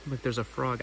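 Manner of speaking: usual speaking voice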